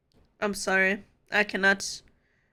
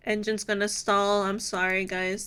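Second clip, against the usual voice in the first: usual speaking voice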